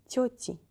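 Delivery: Тёти with a soft, palatalized t. Shown as a demonstration. Teacher repeats the correct plural, soft T. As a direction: say Тёти softening т before и. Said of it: "Т is soft because of the letter я".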